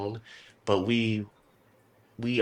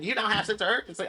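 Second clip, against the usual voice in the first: mocking customer; 9 semitones higher, much faster than usual. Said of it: "She was like".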